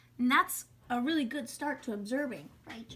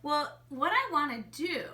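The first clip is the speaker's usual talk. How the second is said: normal talking voice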